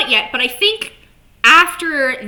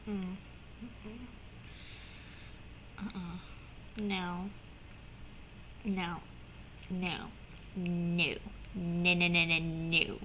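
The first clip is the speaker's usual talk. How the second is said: very soft, low string of noes; muttered refusal; 5 semitones lower, 18 dB quieter, slower than usual. Retold or imitated. Fan recoiling from the scene, soft low repeated noes. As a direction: shake it off under your breath. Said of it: "a visceral reaction where I just went".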